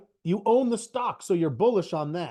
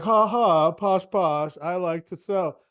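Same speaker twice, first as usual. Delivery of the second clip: mock-posh laugh, a touch louder; hammed-up smug seller; lightly voiced snob gloating; posh snooty; 4 dB louder, slower than usual. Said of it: "they're like".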